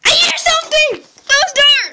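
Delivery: high, loud frightened yell; panicked shout. Pretend. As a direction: scream it in a panic. Panicking at noise in game.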